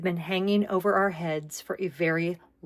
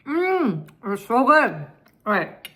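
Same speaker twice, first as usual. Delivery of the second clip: savoring a bite, higher; mmm, louder with food in mouth; mouth full; 4 semitones higher, 4 dB louder, slower than usual. Real, not acted. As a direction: talk through a mouthful of food. Tasting her gnocchi.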